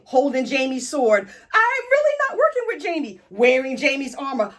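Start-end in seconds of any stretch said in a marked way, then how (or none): 1.5-3.2 high, whiny mock protest of innocence; Brienne. Accused knight pleading with her captors, high mock protest. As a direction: squeal the denial like someone caught red-handed.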